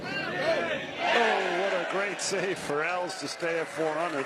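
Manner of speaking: sudden delighted cry on a great save; excited. Real, not acted. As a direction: burst out with real excitement.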